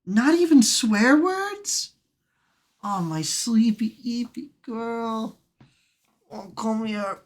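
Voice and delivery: high, slow, drawn-out question to a dog; coaxing pet talk. Real, not acted. Streamer to a silent dog, high slow coaxing. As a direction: stretch it out high, pleading with a pet.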